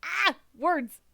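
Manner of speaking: loud, high cry of frustration at tangled words; real comic exasperation at stumbling; exasperated outburst. Real, not acted. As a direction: blurt it out, fed up with the script. Tripping over reading the lines.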